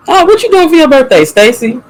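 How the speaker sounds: high, fast playful question; bubbly high-pitched ask; excited teasing. Acted. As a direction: tease her about her birthday.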